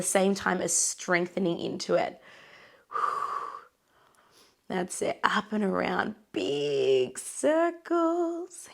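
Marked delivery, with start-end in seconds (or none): 6.3-8.7 very slow singsong, leaping up high; elongated words, rising to a high singsong; drawn-out coaching cue. Real, not acted. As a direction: stretch each word out long to match a big, slow movement. Coach cueing big slow circles.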